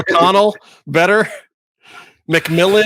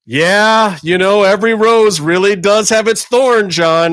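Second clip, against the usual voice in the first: fake-chipper garden-show cohost; mock earnest radio sidekick; cheesy radio sidekick; 3 semitones higher than usual. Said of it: "chime in with things like".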